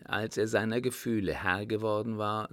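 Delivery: ordinary delivery, nothing marked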